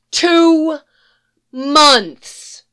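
loud, high exasperated cry; indignant outburst. Acted. Roach fumes at how long Geralt took, loud and indignant. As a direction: blurt it out in disbelief.

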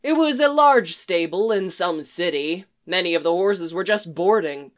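usual speaking voice

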